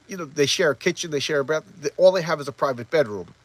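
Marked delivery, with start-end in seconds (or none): none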